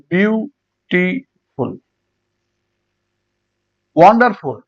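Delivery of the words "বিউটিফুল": বিউটিফুল slowly in three separate beats; syllable by syllable. Shown as a demonstration. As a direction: pause between biu, ti and ful in বিউটিফুল. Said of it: "তিনবার উচ্চারণ করা হয়".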